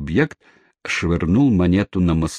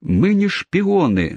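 much higher, slow desperate cry; wailing protest; 11 semitones higher, slower than usual. Acted. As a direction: stress on не, protest in panic. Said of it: "завопил мистер".

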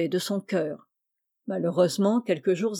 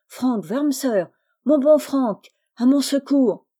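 loud desperate call, higher and louder; crying for help; 5 semitones higher, 5 dB louder than usual. Acted. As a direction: shout for help across the night. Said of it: "il se mit à crier à plein poumon". (appel au secours à pleins poumons)

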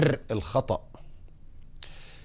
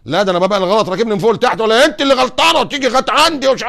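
defensive husband protesting; 7 semitones higher, 7 dB louder than usual. Retold or imitated. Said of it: "بقول لك ايه يا شيخ".

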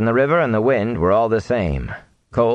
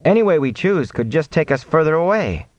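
raised, higher-pitched objection; anxious-to-object higher voice; protesting; 7 semitones higher than usual. A narrator's reading. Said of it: "Matt protested".

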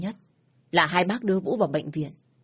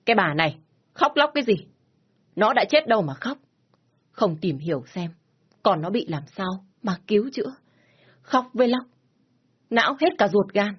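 higher, louder, scolding the crying wife; father snapping; 3 semitones higher, 3 dB louder than usual. Acted. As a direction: cut her off with a sharp rebuke. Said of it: "Bố Vũ lúc này mới gắt lên".

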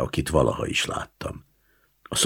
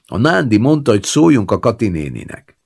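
higher, louder called-in voice; man calling in; 7 semitones higher, 6 dB louder than usual. A narrator's reading. A man calls in from the yard to grandma, raised voice. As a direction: call through the window to the old woman. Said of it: "Egy férfi hang is beszólt".

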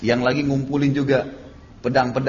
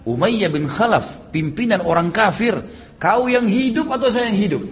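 confrontational challenge; 6 semitones higher than usual. Retold or imitated. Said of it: "Maka Bilal bilang".

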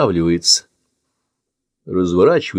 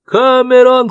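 much higher, louder scream; desperate scream; 8 dB louder, slower than usual. Acted. Andy screams for his fleeing friend, desperate scream. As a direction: scream his name for help. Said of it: "кричит Энди".

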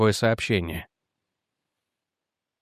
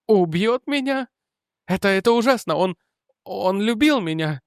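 horrified; 8 semitones higher than usual. Acted. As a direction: recoil in disbelief. Widow horrified at the news. (в ужасе)

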